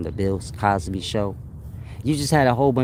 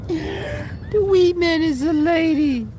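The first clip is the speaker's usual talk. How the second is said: laughing; slower than usual. Real, not acted. Laughing squeal.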